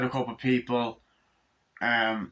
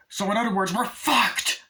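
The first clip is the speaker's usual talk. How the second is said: much higher, louder exasperated shout; despairing yell; 10 semitones higher, 4 dB louder than usual. Retold or imitated. Father yelling at family he's doomed, exasperated shout. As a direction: throw up your hands and yell it.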